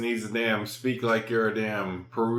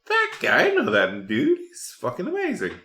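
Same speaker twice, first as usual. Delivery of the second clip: squeaky-high burst of delight; mock-starstruck, voice leaping way up; excited recognition; 10 semitones higher, 4 dB louder than usual. Acts out recognizing actor in old picture.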